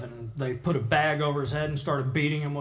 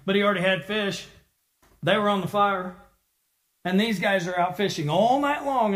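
usual speaking voice

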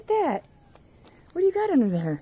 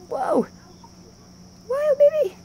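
excited; 14 semitones higher, 4 dB louder, slower than usual. Real, not acted. Excited at the wild pet.

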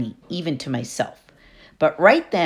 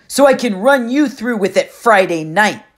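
loud husky threat; threatening bully; 6 semitones higher, 6 dB louder than usual. Acted. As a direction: promise the fight with a bully's swagger. Clarice threatens Annabeth.

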